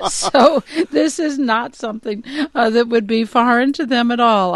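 high, loud, just out of a laugh; amused. Real, not acted. Commentator resuming after laughing at the quote, amused. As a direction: carry on talking, still amused from laughing.

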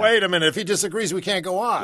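high mock protest; mock alarm. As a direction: play up the crisis. Mock outrage at disagreement.

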